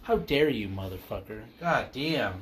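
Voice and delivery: ordinary talk, nothing marked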